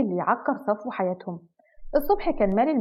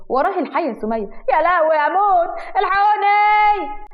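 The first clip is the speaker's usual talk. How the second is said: higher, loud panicked cry while fleeing; panicked screaming; 8 semitones higher, 5 dB louder, slower than usual. Acted. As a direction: scream for help, running from the snake. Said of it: "وهو بيصرخ وبيجري بعيد".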